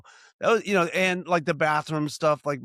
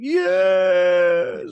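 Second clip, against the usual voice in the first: imitating the little daughter's wailed yes, higher and louder; crying yes; 6 semitones higher, 9 dB louder than usual. Five-year-old confessing to her dad, loud teary wail. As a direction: wail the confession out like a caught kid. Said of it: "Like, she cried".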